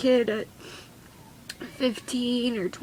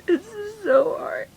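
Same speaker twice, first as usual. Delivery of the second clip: high, tearful, breaking whimper; crying; 8 semitones higher than usual. Real, not acted. Mother admitting to viewers how hard it is, crying. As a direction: squeeze the words out through real tears. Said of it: "I'm sorry I'm crying".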